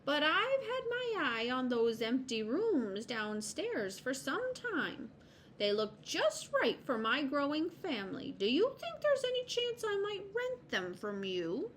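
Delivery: high, hopeful squirrel asking a favour; courteous high-pitched request, rising to the question; polite squirrel. Acted. Voices Mister Squirrel asking.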